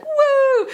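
high, loud imitated whoop; crowd cheer. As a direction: whoop like an audience greeting the act. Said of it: "Everyone goes like".

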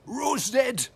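high, loud retold bellow across the pitch. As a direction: shout it across the field. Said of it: "he bellowed across to Bobby".

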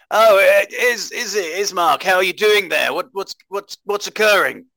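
startled, high-pitched hello; surprised hailing. Acted. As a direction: call out to someone you didn't expect. Hails an acquaintance from the carriage.

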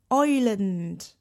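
slow Irish lilt on Ireland; Irish accent. Shown as a demonstration. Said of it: "That was my Irish accent".

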